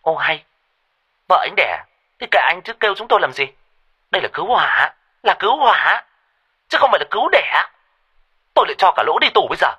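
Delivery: high, fast, shrill-scolding woman; sharp-tongued operator. Acted. Irritated fire operator scolding the panicked husband, sharp and high. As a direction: scold the caller with contempt. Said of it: "bà ấy cất giọng chua ngoa".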